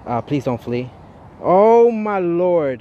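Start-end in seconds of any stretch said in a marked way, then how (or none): 1.4-2.8 drawn-out loud, high oh my lord; loud slow groan as the catch escapes; frustrated groan. Real, not acted. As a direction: stretch it out in real dismay. Pokemon fled, frustrated outburst.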